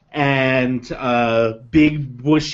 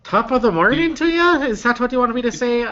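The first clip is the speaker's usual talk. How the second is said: high Irish lilt, a bit grudging; Irish accent on request; 11 semitones higher, faster than usual. Acted. GM does the Irish line the player begged for. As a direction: give them the stock Irish line, eyebrows raised. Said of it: "go ahead, go ahead".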